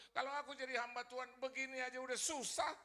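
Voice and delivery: loud, high protest; insolent challenge. Acted. Son protesting to his mother about serving God, insolent. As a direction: argue back hard at mother. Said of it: "Kurang ajar sama ibu saya".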